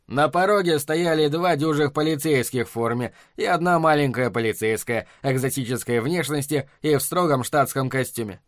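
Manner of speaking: ordinary delivery, nothing marked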